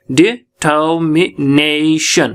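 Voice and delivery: Determination very slowly in separate syllables, ने stretched; determination broken up slowly, weight on ने; stress on fourth syllable. Shown as a demonstration. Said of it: "ने हमारा fourth syllable है".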